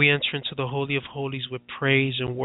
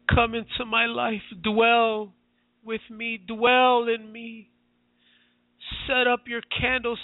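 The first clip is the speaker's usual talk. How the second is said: higher, tearful-edged plea that climbs as it goes; pleading prayer; 9 semitones higher than usual. Acted. Pastor voicing a repentance prayer for listeners, pleading and high. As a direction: cry out to Jesus in repentance, begging. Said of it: "Say right now".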